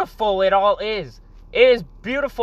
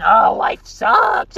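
slow, drawn-out, shouted moan; mocking complaint; 2 semitones higher, 4 dB louder, much slower than usual. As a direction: ham up a whiny complainer. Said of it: "and saying".

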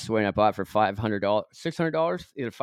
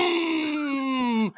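much higher, sputtering mock engine revving; imitated engine roar. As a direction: make the noisy car rev like a clown car. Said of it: "and the car's like".

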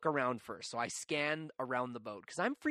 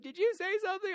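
put-on much higher, light innocent girl's voice; Katie's innocent question; 5 dB louder, faster than usual. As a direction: play the sweet friend, curious and oblivious. Katie popping up, asking.

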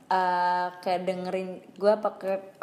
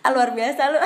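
laughing; 4 semitones higher, 8 dB louder than usual. Real, not acted. Teasing a friend, laughing.

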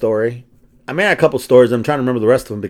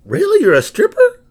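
retold surprise; 4 dB louder than usual. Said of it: "And I'm like".

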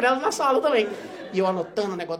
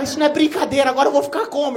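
put-on angry girlfriend; 7 semitones higher, 6 dB louder than usual. Girlfriend angry over broken case.

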